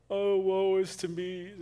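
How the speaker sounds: slow, hammed-up self-pity; lightly voiced whiny prophet; mock melodramatic woe. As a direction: sigh it out like a sulking martyr. Mocking Jonah's self-pity.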